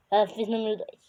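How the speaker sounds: usual speaking voice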